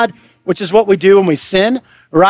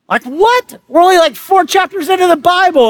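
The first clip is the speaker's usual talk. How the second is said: incredulous; 8 semitones higher than usual. Real, not acted. Shocked by murder in Genesis.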